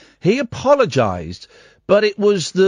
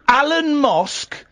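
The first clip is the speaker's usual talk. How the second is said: incredulous; 9 semitones higher, slower than usual. Real, not acted. Host mocking the name incredulously.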